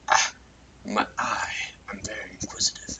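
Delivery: breathy, fast curious whisper. Acted. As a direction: whisper it like a nosy creature. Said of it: "and he's like".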